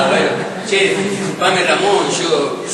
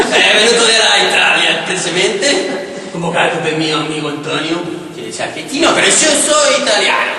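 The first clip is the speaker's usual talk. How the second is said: put-on Italian accent. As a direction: play it as an over-the-top Italian. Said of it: "a mi Antonio".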